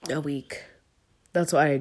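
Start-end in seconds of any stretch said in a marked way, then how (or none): none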